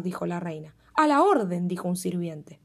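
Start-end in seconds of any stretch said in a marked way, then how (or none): 0.9-1.7 servant obeying. Acted. Servant obeys.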